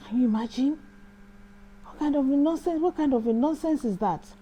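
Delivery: indignant complaint. Retold or imitated. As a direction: vent your offence to God.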